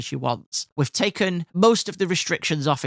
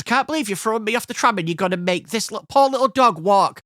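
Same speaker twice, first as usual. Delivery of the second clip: high protest, building louder at the end; loud public complaint; 6 semitones higher, 3 dB louder than usual. Retold or imitated. Passenger appealing to the tram over his dog, loudly aggrieved. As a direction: play up the grievance for an audience. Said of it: "so that everybody could hear".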